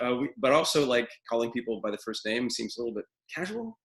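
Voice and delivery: normal talking voice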